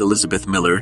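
usual speaking voice

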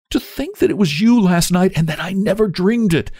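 high, amazed and overjoyed; joyful exclamation. A narrator's reading. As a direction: exclaim it in happy disbelief. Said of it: "exclaimed Theodora".

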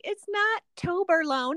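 high-pitched imitation; teasing correction. Retelling Ramel correcting her, high mocking voice. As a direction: mimic someone amused and correcting. Retold correction by the laughing man.

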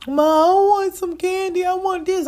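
put-on whining child voice. Voices son Nigel whining.

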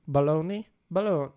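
"Boulogne?" tried as bo-LOH-nee, rising, unsure; test-run "Boulogne?" like a guess; unsure pronunciation. Shown as a demonstration. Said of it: "No, that's ... that's Italian".

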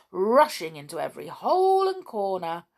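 ordinary delivery

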